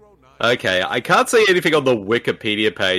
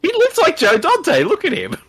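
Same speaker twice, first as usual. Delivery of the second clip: excited high insistence. Real, not acted.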